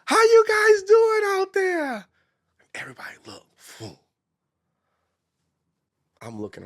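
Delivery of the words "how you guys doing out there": high, slow, stretched-out crowd shout; big MC shout. Retold or imitated. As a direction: hype up the whole room. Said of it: "I literally cleared my throat".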